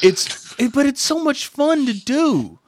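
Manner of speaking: gleeful. Real, not acted. Host defending rooting salespeople, slow gleeful delight. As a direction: savour every word with glee.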